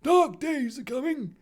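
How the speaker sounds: earnest Dumbledore. Acted. Dumbledore warning Harry, earnestly. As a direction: warn in a put-on headmaster voice. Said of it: "Dumbledore replied earnestly".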